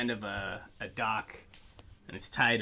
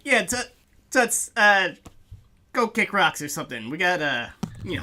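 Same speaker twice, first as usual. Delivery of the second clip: loud, high brush-off; dismissive orc bouncer; 5 semitones higher, 6 dB louder than usual. Acted. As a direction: wave them off, scornful and loud. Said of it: "the orc's like".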